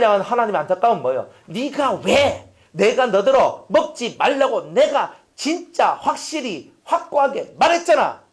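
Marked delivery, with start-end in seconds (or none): 1.5-8.2 slow, raised rebuke from God; God reproaching Adam for disobeying; grieved God. Acted. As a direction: plead and scold as a hurt father.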